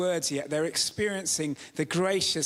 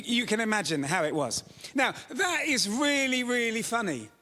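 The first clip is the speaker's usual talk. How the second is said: ordinary talk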